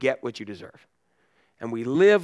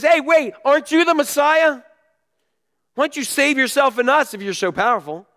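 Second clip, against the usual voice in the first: mocking taunt; 10 semitones higher, 5 dB louder than usual. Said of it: "And he says".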